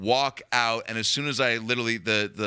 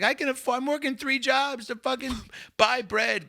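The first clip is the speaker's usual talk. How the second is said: indignant mom; 12 semitones higher than usual. Retold or imitated. Mom scolding.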